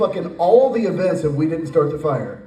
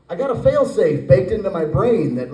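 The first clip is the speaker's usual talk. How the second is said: ordinary delivery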